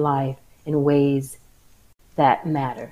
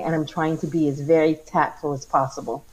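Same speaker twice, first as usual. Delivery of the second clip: usual speaking voice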